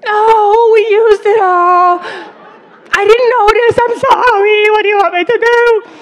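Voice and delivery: very high, loud, wailing protest; hysterical yelling back. Retold or imitated. Sandwich maker answering mustard meltdown, wailing back hysterically. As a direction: shriek your apology back, hammed-up and tearful.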